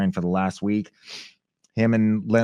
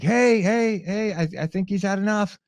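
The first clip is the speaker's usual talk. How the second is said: calming plea; 11 semitones higher than usual. Retold or imitated. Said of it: "I'm like".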